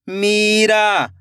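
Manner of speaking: Meera joined as one slow word; blended word. Shown as a demonstration. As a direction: read Meera whole, falling at the end. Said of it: "खींच के पढ़ना है".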